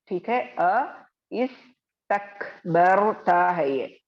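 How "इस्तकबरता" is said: इस्तकबरता recited slowly and louder; recited Quranic reading. Shown as a demonstration. Teacher reciting इस्तकबरता for students after correcting herself. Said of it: "sorry".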